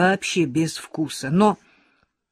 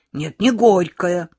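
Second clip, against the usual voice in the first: offended; 2 semitones higher, 8 dB louder, slower than usual. A narrator's reading. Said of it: "обиделась Лёлька".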